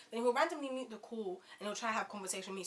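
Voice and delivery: ordinary talk, nothing marked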